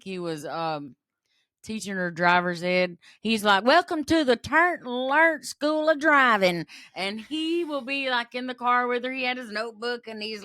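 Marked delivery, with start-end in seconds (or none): 3.6-6.6 loud, high-pitched welcome spiel; hammed-up driving-school greeting; imitated driving instructor. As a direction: announce it big as a play-acting instructor. Retold dad playing driving instructor.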